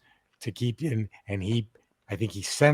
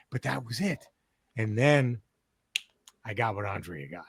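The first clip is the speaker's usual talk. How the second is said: ordinary talk, nothing marked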